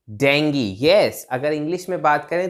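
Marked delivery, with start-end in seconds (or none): 0.1-0.8 डेंगी with a 'gee' ending; correct pronunciation. Shown as a demonstration. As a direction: say डेंगी firmly, falling at the end. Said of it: "correct pronunciation है डेंगी". (सही उच्चारण, आख़िर में 'गी')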